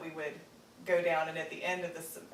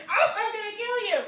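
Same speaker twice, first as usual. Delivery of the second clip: laughing mock threat, much higher and louder; 10 dB louder than usual. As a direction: play the brother's fake fury through laughter. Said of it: "He's like".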